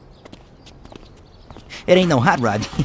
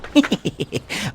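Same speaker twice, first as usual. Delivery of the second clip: high, quiet giggle; 7 semitones higher, 5 dB quieter than usual. Acted. Mechanic seeing his visitors, high soft giggle. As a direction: giggle softly in a high pitch.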